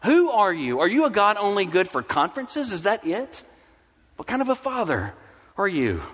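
high-pitched, heated questioning; retold prayer of accusation; accusing God. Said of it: "began to accuse God".

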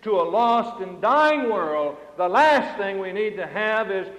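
loud, emphatic pulpit delivery; forceful preaching. Acted. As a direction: preach it out, leaning hard on key words.

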